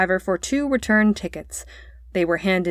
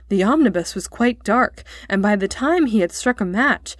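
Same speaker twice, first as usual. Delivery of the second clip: ordinary delivery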